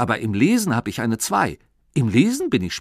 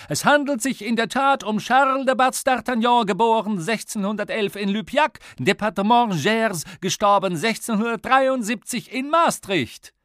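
high, loud excited announcer; quiz host; 12 semitones higher, 5 dB louder than usual. Acted. Radio host announcing correct answer, high triumphant.

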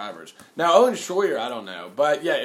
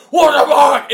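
high, loud mock outraged shout; Alex Jones; 10 semitones higher, 12 dB louder, slower than usual. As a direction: yell it in hammed-up shock. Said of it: "And Alex Jones is like".

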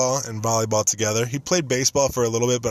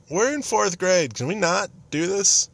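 high, slow, pleading put-upon whine; mock exasperated; 9 semitones higher, slower than usual. Fourth grader begging the cheerleaders to stop, slow and whiny. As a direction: roll the eyes at them. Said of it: "And I was like".

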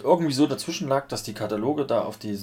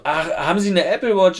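knowing operator; 9 semitones higher, 7 dB louder than usual. Retold or imitated. Operator retold.

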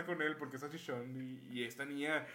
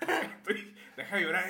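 laughing denial; 4 semitones higher, 7 dB louder than usual. Real, not acted. Laughing while denying crying.

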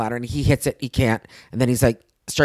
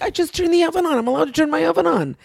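much higher, pleading self-defence; indignant protest; 14 semitones higher than usual. Retold or imitated. Tenant defending herself to angry helper, high indignant protest. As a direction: protest your innocence, voice right up. Acting out her protest to him.

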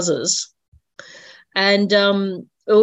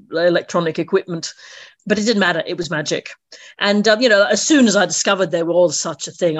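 usual speaking voice

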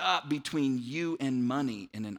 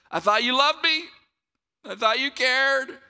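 wounded complaint; 7 semitones higher, 6 dB louder than usual. Acted. Preacher voicing people who doubt God's goodness, wounded protest. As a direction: play up the betrayed whine. Acting out hurt doubters of God.

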